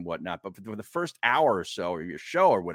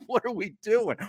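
exasperated question; 13 semitones higher than usual. Retold or imitated. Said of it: "I'm like".

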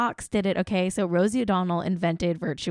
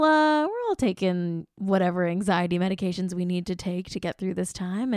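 ordinary talk, nothing marked

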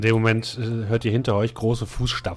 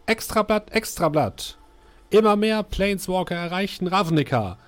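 newsboy calling out; 10 semitones higher than usual. Acted. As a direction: hawk the extra edition like a street boy. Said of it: "einen Jungen rufen". (rufender Zeitungsjunge, hoch und laut)

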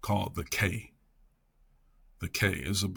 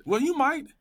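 pitched high and a little louder; retold grandfather; 4 dB louder than usual. Man passing on his grandfather's advice, retold grandfather. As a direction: retell granddad's aside with a high lift. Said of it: "He said".

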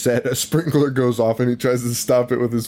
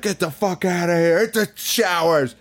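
higher, put-on angry coach bark; 7 semitones higher than usual. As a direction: play the furious coach chasing the kids out. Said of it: "he gets so fucking mad at the kids".

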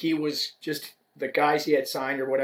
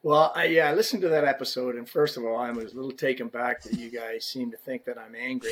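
ordinary talk, nothing marked

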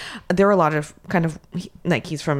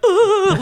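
high, loud "ugh" of welling tears; sudden high-pitched blubber, played for laughs; mock crying wail; 5 dB louder than usual. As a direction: burst into a comic high wail. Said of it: "like, start crying".

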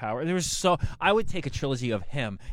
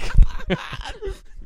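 high laugh; 10 dB louder than usual. Real, not acted. Laughing at friend's joke.

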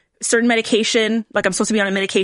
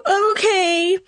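small, high whimpered okay; mock tearful; 9 semitones higher than usual. Patient answering reassuring doctor, imitated tearful voice. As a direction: imitate agreeing through sniffles.

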